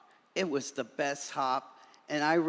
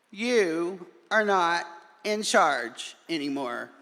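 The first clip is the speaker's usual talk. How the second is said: slow, louder firm words; defiant declaration; 5 semitones higher, 8 dB louder than usual. A narrator's reading. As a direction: tell him off firmly. Friends defy stink bug together.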